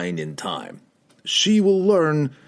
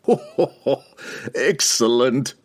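acted gloating ho-ho laugh, higher; villainous laugh; 5 semitones higher than usual. A narrator's reading. Pook enjoying Regis's desperate lie, gloating villain laugh. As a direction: laugh it out, then relish the word. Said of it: "Pook laughed".

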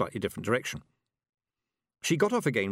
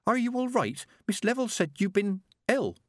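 put-on high, hesitant young girl; girl reporting to the witches; nervous girl; 11 semitones higher than usual. A narrator's reading. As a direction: stammer out the news shyly. Petulia asking Tiffany.

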